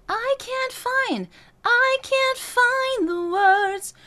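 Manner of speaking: very high, slow spoken line; high speech placement. Shown as a demonstration. Voice coach speaking high to find head voice. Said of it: "once you get comfortable there".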